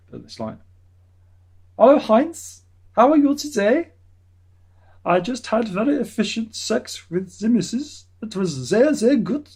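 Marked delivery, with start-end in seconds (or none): 1.8-9.5 high, loud mock German accent; hammed-up German man boasting to a friend; German-Akzent, übertrieben hoch und laut. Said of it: "that's how the Germans talk".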